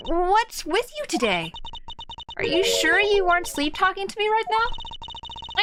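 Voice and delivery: put-on high playful young woman; teasing girl voice. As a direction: tease him brightly. Voicing Kay teasing.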